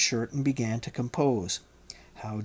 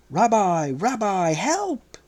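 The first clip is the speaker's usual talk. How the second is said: desperate cry for help, rising much higher; panicked shout; 7 semitones higher, slower than usual. A narrator's reading. Peter clinging to the mast cries out in panic. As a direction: scream for help, going under.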